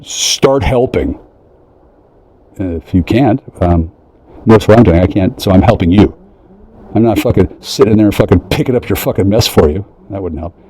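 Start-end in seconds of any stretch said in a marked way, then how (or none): none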